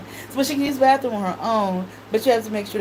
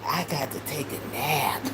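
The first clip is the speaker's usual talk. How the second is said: put-on soft, lower little-girl voice; child's voice; 4 semitones lower, 5 dB quieter than usual. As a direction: relay it like a small child. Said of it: "She said".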